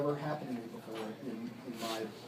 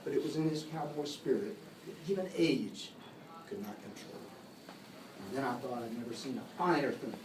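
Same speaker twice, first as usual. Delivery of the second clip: measured cowboy verse, letting line endings fall away; poem recitation. Acted. Reciting a cowboy poem to a gathering, poetic recitation. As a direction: recite it with a swell, then hush the refrain.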